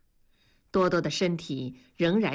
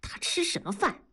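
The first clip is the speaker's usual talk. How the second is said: higher-pitched, contained spite; resentful; 7 semitones higher, 4 dB quieter than usual. A narrator's reading. Young woman refusing the newcomer a meal, resentful. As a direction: throw it out bitterly, without shouting. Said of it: "燕妮恨道".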